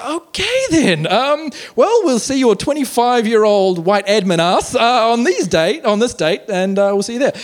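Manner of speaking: high, drawn-out imitation of a let-down caller; mock polite disappointment, slow and high; awkward dismay. Voices caller's disappointed sign-off.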